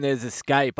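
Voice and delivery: normal talking voice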